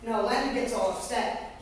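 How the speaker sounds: normal talking voice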